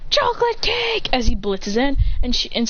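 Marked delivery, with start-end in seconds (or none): 0.1-1.1 high excited shout of delight. Acted. Boy hears about dessert, high excited cry. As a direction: squeal for the cake.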